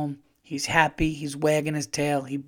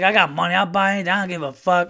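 put-on louder, higher drunk boast; drunk bragging; 5 semitones higher, 6 dB louder, faster than usual. Drunk guy insisting on buying shots, loud and higher. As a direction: brag about your money like a happy drunk.

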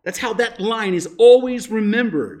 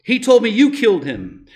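put-on higher, louder, accusing young hero; Luke's reply; 4 semitones higher, 6 dB louder than usual. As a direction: throw the line back at him in protest. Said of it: "Luke responds with".

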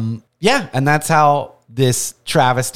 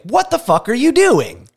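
incredulous; 9 semitones higher than usual. Retold or imitated. Wife reacting in disbelief.

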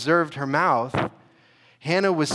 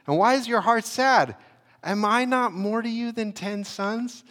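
a husband's voice pitched much higher, puzzled and pleading; 9 semitones higher than usual. Acted. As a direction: read it as the baffled husband begging his wife to cheer up. Pastor reads husband's words in character.

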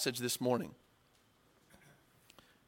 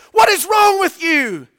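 slow, much higher and louder enraged yell; furious shout; 14 semitones higher, 12 dB louder than usual. Retold or imitated. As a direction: roar it out after nearly being drowned. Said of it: "and he's mad".

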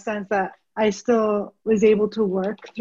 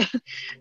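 soft laugh; 9 dB quieter than usual. Real, not acted. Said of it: "I don't even know why I'm laughing".

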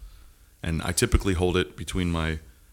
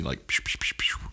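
soft psh psh spray noises; imitated pee spray sounds; 7 semitones lower, 9 dB quieter than usual. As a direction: hiss like a laser stream. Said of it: "you laser 'em off".

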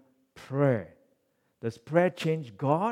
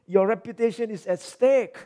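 Moses begging God, pitched high and urgent; pleading Moses; 9 semitones higher, 6 dB louder than usual. Acted. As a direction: plead desperately for the people, voice raised high. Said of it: "He said".

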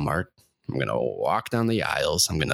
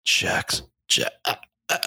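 choking. Pretend. Choking off while dying.

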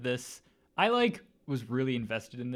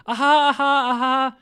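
high, loud forced laugh imitation; fake laugh; 13 semitones higher, 15 dB louder than usual. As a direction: mimic an actress's fake interview laugh. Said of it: "it sounds like a fake laugh".

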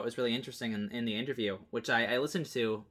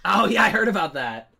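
excited; 8 semitones higher, 11 dB louder than usual. Real, not acted. Reacting to Bethesda blacklist news.

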